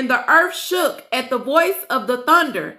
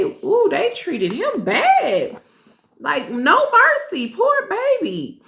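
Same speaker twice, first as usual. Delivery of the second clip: pitying sigh; 6 semitones higher, slower than usual. Real, not acted. Reader pities Joseph.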